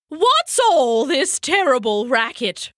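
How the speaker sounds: owl calls out, annoyed; calling out. Acted. Owl calls from her tree at the noisy singer, annoyed. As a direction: call loudly toward the noise. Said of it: "she called out".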